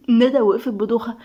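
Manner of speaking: ordinary delivery, nothing marked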